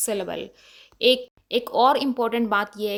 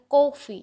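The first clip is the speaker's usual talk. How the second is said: Coffee with a higher-pitched, stressed कॉ; coffee, falling away after a stressed कॉ; stress on first syllable; 3 semitones higher, 4 dB louder than usual. Shown as a demonstration. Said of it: "को पे थोड़ा हम ज़्यादा stress".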